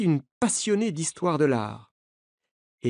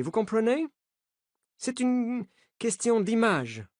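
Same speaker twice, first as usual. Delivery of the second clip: put-on the coach, higher, with an American accent, insistent; 4 semitones higher than usual. A narrator's reading. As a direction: warn the journalists in an American accent. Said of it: "avec un accent américain". (accent américain, insistant)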